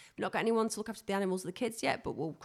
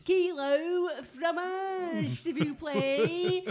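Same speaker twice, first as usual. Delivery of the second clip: high, slow, drawn-out French; mock French accent; 7 semitones higher, 3 dB louder, much slower than usual. Host ordering cheese in high, drawn-out mock French. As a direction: stretch every French word out, high-pitched.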